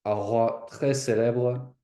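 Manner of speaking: slow French accent, carefully spaced; French repeat-after-audio. Shown as a demonstration. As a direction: echo the French recording slowly. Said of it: "Play the first part, pause".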